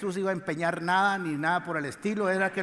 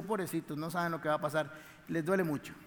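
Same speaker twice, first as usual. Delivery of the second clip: much softer, hushed and gentle; tender pity; 4 semitones lower, 8 dB quieter, faster than usual. Pretend. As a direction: murmur it like comforting grieving people. Jesus imagined pitying the mourners.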